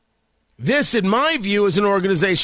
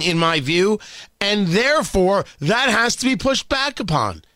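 ordinary talk, nothing marked

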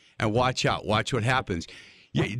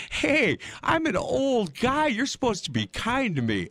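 high-pitched, drawn-out plea for kindness; mock indignant protest; 9 semitones higher than usual. Old guy to softball girls who showed no mercy, mock indignant. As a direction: protest high and slow, playing hard done by. Retold protest at the young players.

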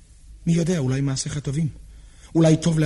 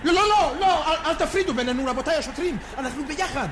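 frantic plea; 8 semitones higher than usual. Acted. Pleading with police officers.

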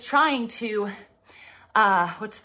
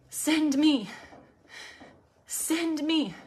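higher, fully voiced plea; 6 semitones higher, much slower than usual. Preacher repeating the prayer to God, high voiced plea. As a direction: lift the offer up out of the whisper.

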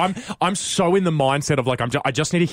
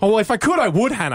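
raised, mock-upset shout; mock yelling; 9 semitones higher than usual. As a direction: shout it back, playfully indignant. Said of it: "Now you're yelling at Hannah".

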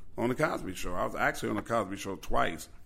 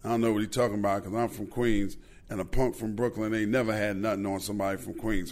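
normal talking voice

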